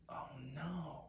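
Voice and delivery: very soft, breath-filled "oh, no"; hushed dismay. Acted. Disciples learning Lazarus is dead, hushed dismay. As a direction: let the news sink in, near a whisper.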